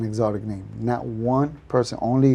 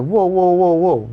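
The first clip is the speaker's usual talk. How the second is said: higher string of whoas, retold; protesting interruption; 5 semitones higher, slower than usual. Contractor stops his upset friend, protesting. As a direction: cut in to halt an accusation. Acts out halting angry friend.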